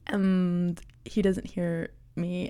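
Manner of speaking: ordinary talk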